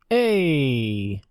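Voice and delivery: high cartoon Canadian outcry, drawn-out "hey"; mock Canadian accent. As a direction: whine as an offended put-on Canadian. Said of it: "your Canadian accent".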